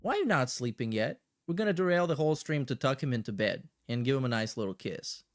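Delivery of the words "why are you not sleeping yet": ordinary delivery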